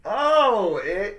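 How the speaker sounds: sudden high loud gasp of surprise; a startled, loud, high Oh; surprised. Real, not acted. Surprise at the ticket draw.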